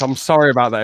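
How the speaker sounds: usual speaking voice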